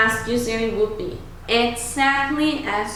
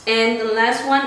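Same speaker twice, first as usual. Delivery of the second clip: normal talking voice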